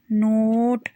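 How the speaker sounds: each word said slowly and clearly, with a pause after it; word list drill. Shown as a demonstration. Teacher reading practice words for children to repeat, slow and clear. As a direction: model every word for the class to copy. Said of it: "एक बार और पढ़ते हैं।".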